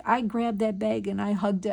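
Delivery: usual speaking voice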